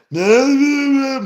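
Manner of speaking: high wailing laugh-sob; a loud high whimpering wail; crying laugh. Retold or imitated. Friend high on salvia, crying and wailing. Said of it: "just crying and".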